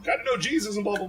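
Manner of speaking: mock preachy high voice; high mocking impression of a believer; mocking Hollywood. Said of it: "they make fun of that".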